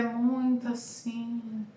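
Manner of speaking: droning monotone demo. Shown as a demonstration. Voice coach showing students a dull voice, monotone. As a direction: stretch it out on one held note. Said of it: "A monotonia vocal, ela é chata".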